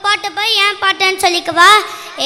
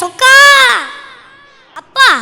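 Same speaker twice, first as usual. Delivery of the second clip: loud rising cry higher in pitch; calling out to god; 5 semitones higher, 8 dB louder than usual. Acted. Poet crying out to Lord Chokka for help, loud. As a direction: shout the god's name skyward.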